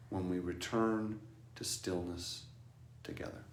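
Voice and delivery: slow, hushed contemplative voice; meditative reflection. Acted. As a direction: let each phrase settle softly.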